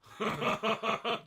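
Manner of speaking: high laugh; real amused laugh; burst of laughter. Real, not acted. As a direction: laugh out loud at it. Laughing at the joke.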